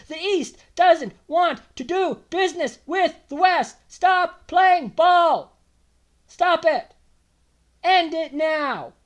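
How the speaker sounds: loud, high, drawn-out words hammered one by one; angry demand. Real, not acted. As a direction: order them to stop, loud and slow. Angry rant addressed at the premier.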